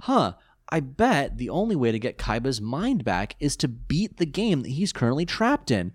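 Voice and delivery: high puzzled musing, slowing; hammed-up pondering hero; mock detective musing.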